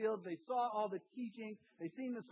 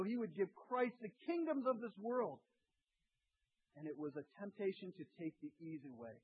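normal talking voice

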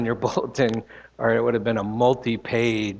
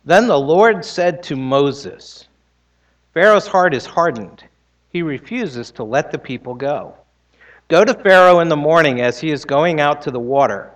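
louder, proclaiming scripture voice; scripture reading; 3 semitones higher, 5 dB louder than usual. Acted. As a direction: read the Lord's words with weight. Reads Exodus aloud in sermon.

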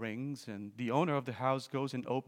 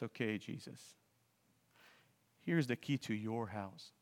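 quiet surrender; 2 semitones lower, 4 dB quieter than usual. Acted. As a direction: give it all up gently, softly. Man surrenders house to Jesus.

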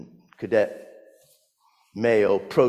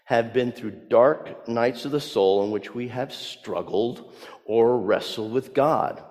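ordinary delivery

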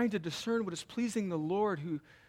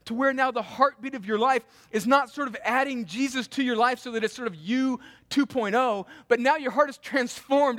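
loud, raised-pitch preaching with punched key words; impassioned preaching; 7 semitones higher, 9 dB louder than usual. Real, not acted. Preacher urging congregation to make life about Christ, impassioned. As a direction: press the point home at full force.

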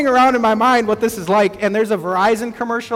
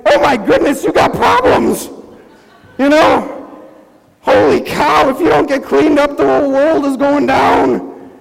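hammed-up shocked finger-pointing, louder and higher; mock outraged; 3 semitones higher, 4 dB louder than usual. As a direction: play the scandalised judge of someone else's mess. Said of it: "sometimes we're like".

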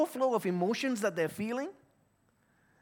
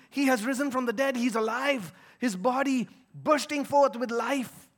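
ordinary talk, nothing marked

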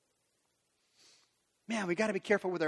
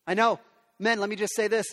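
ordinary talk, nothing marked

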